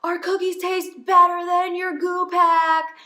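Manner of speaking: high, loud call from the sidelines; cheering dad. Retold or imitated. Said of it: "the dad said".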